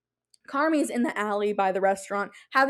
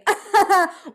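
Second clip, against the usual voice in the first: burst of laughter; 8 semitones higher, 7 dB louder than usual. Real, not acted. Laughs at own mock line.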